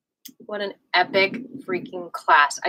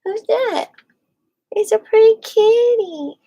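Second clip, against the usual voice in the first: high, louder pet-talk coo; 11 semitones higher, 6 dB louder than usual. Real, not acted. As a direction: gush over the kitty in a high voice. Cooing at her cat.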